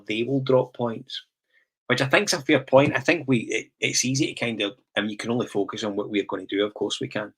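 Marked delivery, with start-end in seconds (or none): none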